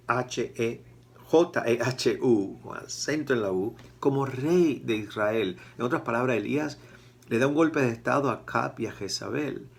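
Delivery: ordinary talk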